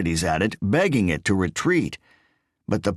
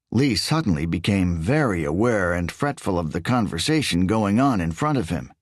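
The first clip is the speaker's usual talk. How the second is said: plain reading voice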